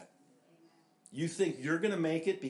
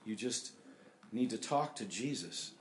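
ordinary talk

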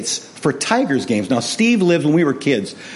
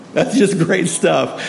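ordinary talk

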